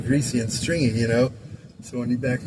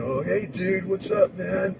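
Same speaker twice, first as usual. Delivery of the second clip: higher, laid-back surfer stoner greeting; stoner dude; 7 semitones higher, slower than usual. Retold or imitated. [name] imitating how people expect him to talk as a surfer stoner. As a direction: play the doped-up teen dude. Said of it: "people think I should be walking".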